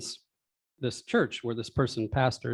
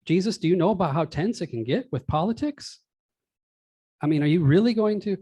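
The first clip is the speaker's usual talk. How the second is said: rising, exasperated rant; old man rant; 6 semitones higher, 3 dB louder than usual. Acted. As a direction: push the disbelief up and out. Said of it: "an old man rant in the middle".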